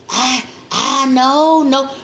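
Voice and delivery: slow, halting mock denial; hesitant patient. Imitating a patient reluctantly admitting trouble, slow halting voice. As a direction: stall like someone caught out.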